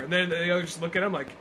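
ordinary delivery

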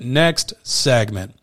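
next segment, very slow and stretched; drawn-out announcement. Real, not acted. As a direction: drag the words out playfully. Repeating the segment cue.

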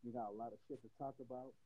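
muffled, far from the mic; bad mic, muffled audio. Real, not acted. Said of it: "you sound real muffled".

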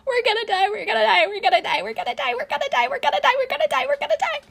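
very high, squealed panic loop; shrieky, hysterical chant of doom; panicked wailing. A narrator's reading. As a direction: wail it over and over without a break. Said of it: "continues to let out wails of".

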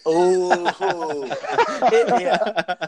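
laughing out loud. Real, not acted. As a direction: burst out laughing. Laughing at the French joke.

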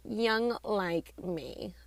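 slow, soft, drawn-out tease. Real, not acted. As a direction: stretch it out playfully. Repeated teasing aside.

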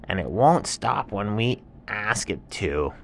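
high animal voice settling down; complaining animal. Acted. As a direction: grumble about the tiger. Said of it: "And the animal said".

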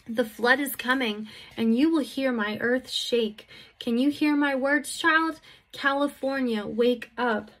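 impassioned preaching of a divine warning; prophetic message. Acted. Delivers message as God speaking.

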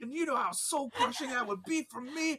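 high, loud Southern whine breaking into laughter; Southern accent, laughing. Retold or imitated. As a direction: plead like a Southern barista who needs his money. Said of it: "Why is he suddenly Southern?".

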